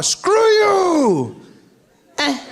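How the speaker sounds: high, drawn-out shout; weaker mock yell. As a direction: yell it with no phone to slam. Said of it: "there's no sense of power".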